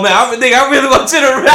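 high-pitched helpless laughter; laughing hard. Real, not acted. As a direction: crack up while talking. Laughing at own mix-up.

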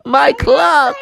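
high, wailing cartoon lament; mock-wail over a precious loss, pitched high; distraught rabbit. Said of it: "the rabbit was like".

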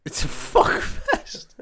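slow, high, breathy laughing echo. Real, not acted. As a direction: laugh the words out in disbelief. Echoes friend's line, laughing.

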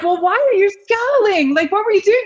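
high-pitched questioning friend; mock-incredulous high voice; imitating friend. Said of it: "And he was like".

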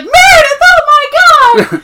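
very high, loud mock scream; scandalised squeal, stretched out; horrified shriek. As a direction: shriek in hammed-up horror on the edge of laughter. Acting out horrified discovery.

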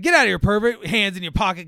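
imitated irate telling-off; angry confrontation. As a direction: shoo away a suspected creep. Retold confrontation at playground.

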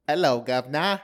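loud cockney-style English greeting; hammed-up English accent. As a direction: call it out in a mock English accent. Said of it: "English accent".

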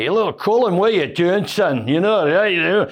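high-pitched imitation of a Scottish neighbour's accent; Mister Mac. Imitating the man up the road greeting young Colin, Scottish accent. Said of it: "Mister Mac, and he said".

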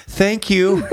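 high little-girl imitation; grateful toddler. Toddler thanking guests for coming to her party, imitated high-pitched voice. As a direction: mimic a two-year-old politely thanking guests. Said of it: "And she says".

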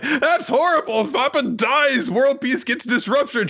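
put-on high, squeaky little-girl voice; tiny kid squeal of panic; Anya voice. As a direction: pitch way up like a small child. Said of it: "goes over to the dog and is like".

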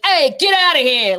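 loud Italian-family style brush-off. Acted. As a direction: shoo them off in a big Italian-American accent. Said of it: "I wanna use that accent".

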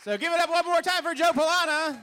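announcer hype. Real, not acted. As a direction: whip the crowd up for the winner. Host announces the winner.

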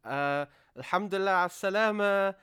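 prompted reply. Retold or imitated. Child on phone repeating parent's coaching, halting reply. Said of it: "I'm like".

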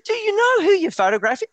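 high, loud disbelieving question; imitated incredulity, loud and high; incredulous challenge. Acted incredulous TV man challenges him.

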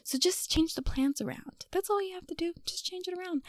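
high soft whisper; hushed bossing of God; whispered bossy ask. Pretend. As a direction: whisper instructions conspiratorially.

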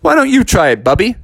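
high, punchy invitation; father's challenge. Acted. Father inviting Bubby to try riding, lightly hammed-up urging. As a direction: toss him the challenge. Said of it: "He's like".

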